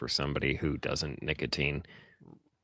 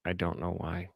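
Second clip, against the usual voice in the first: ordinary delivery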